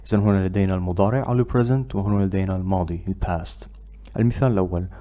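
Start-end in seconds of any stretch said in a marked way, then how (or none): none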